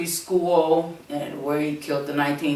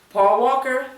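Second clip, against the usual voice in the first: swooning over Paul Walker, pitched higher; mushy; 9 semitones higher, 3 dB louder than usual. Real, not acted. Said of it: "If y'all see me get a little mushy".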